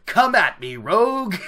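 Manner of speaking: cocky madman with a loud mock laugh; laugh, then boldly dare them to attack; hammed-up swaggering challenge; Lews Therin. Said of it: "Lews Therin's just like".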